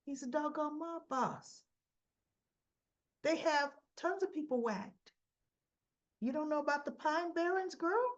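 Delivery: incredulous rebuke. Retold or imitated.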